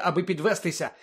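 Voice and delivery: usual speaking voice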